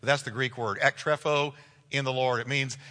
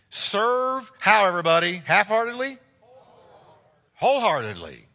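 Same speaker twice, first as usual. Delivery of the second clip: raised, loud pulpit exhortation; forceful preaching; 7 semitones higher, 6 dB louder than usual. Real, not acted. Preacher urging the congregation to work wholeheartedly, forceful preaching. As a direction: drive it home to the congregation.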